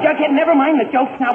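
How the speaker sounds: usual speaking voice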